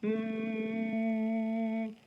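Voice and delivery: steady hum through the nose; closed-mouth hum. Shown as a demonstration. Teacher humming with mouth closed for the kids. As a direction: hum with lips shut. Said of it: "close your mouth and don't let the sound come out".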